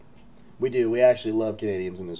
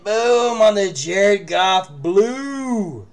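slow, loud, higher "boom"; drawn-out hype, louder and higher; excited hit call; 9 semitones higher, 10 dB louder, much slower than usual. Real, not acted. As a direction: call out the big pull. Big hit reveal.

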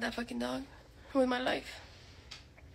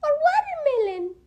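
squeaky high offer, very high up; high dog-talk voice; 12 semitones higher, 4 dB louder than usual. Shown as a demonstration. Offering his dog treats in a squeaky high voice. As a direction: tempt the dog in a sky-high sing-song. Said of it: "I could be like".

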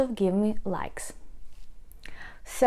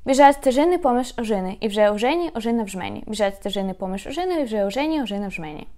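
fast tongue twister; much faster than usual. Shown as a demonstration. Teacher showing off the tongue twister at full speed. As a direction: race through it twice. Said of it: "And one more time".

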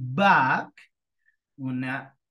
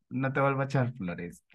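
quick, soft words through a laugh; laughing tease; 5 semitones lower, 5 dB quieter, faster than usual. Real, not acted. Teacher teasing a shy student, laughing softly. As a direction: tease her gently while laughing.

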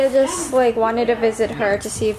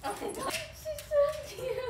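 gushing; 13 dB quieter than usual. Real, not acted. Gushing over puppy.

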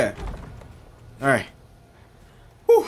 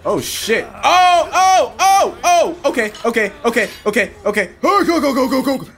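high, loud panicked yelps; panicking gamer; 8 semitones higher, 3 dB louder than usual. Real, not acted. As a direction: yelp in real panic. Sudden attack, panicked yelps.

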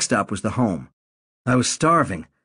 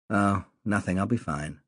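mom's moody reply; 2 semitones lower, 6 dB quieter than usual. A narrator's reading. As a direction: say it flat and withdrawn, quietly. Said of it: "Not, "I'm fine," I noticed".

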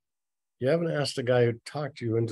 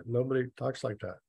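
ordinary delivery

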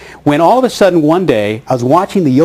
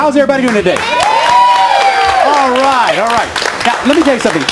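a hint of a stand-up greeting the room, higher; comic stage opener; 8 semitones higher, faster than usual. Comedian greets the studio crowd, light stand-up voice. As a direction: open like a club comic warming up the crowd. Comedian opening his set.

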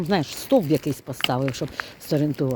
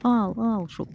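imitating a call; 8 semitones higher, slower than usual. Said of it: "а Саня кричить".

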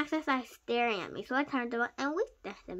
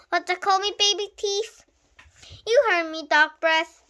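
loud, raised taunts; quarrelsome shouting; 6 semitones higher, 8 dB louder than usual. A narrator's reading. Boy and bully squaring off, quarrelsome shouting. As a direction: trade insults at full voice.

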